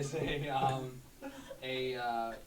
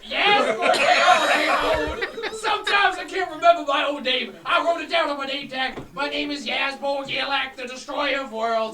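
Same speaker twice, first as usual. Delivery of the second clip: potion seller; 11 semitones higher, 14 dB louder than usual. Acted. Seller recalls own name.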